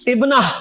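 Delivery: ابنہ ending in a breath of H, no T; final H sound. Shown as a demonstration. Teacher showing how round ta ends, ابنہ with final H. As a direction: end ابنہ on an H instead of T. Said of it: "میں ہا کی آواز نکال رہا ہوں".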